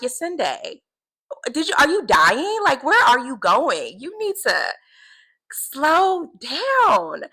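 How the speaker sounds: mock-shocked voice of God asking if she's dying; God teasing her. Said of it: "it's like".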